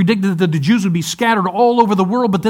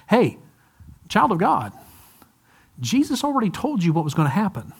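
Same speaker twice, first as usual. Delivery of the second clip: gentle appeal; 2 semitones higher, 6 dB quieter than usual. Acted. Angel addresses believer tenderly.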